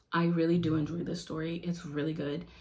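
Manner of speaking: usual speaking voice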